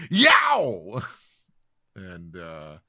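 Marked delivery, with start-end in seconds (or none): 0.1-1.0 loud high yelp; a sudden giant yowl; yelp of pain. Acted. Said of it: "Yarrow just goes".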